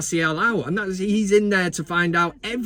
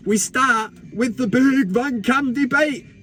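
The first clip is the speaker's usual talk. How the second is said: show announcer; 5 semitones higher, slower than usual. Acted.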